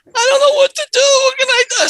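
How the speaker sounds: imitating a helpless man at a loss; hammed-up distressed customer, high-pitched; panicked customer. Stranded customer voiced in story.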